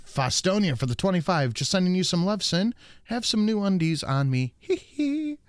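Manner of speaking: high-pitched pop star impression with a "hee hee". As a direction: read it out in a high mock singer's voice.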